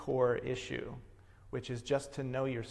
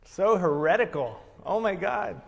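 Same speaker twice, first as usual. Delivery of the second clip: louder, higher, delighted shock; gleeful exclamation; 6 semitones higher, 9 dB louder than usual. Real, not acted. Teacher to students, thrilled by a daring reading: gleeful exclamation. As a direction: relish how scandalous it is.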